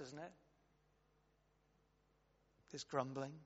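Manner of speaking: ordinary talk, nothing marked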